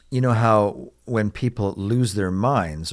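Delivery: ordinary talk, nothing marked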